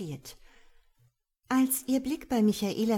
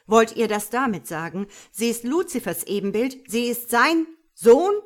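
louder questions climbing to a horrified last word; mounting horror; 3 semitones higher, 11 dB louder than usual. A narrator's reading. God grasps whose child it is, horrified. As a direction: build to sheer horror on 'Sohn'. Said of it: "Das blanke Entsetzen spricht".

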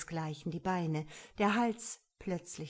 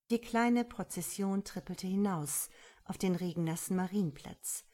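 usual speaking voice

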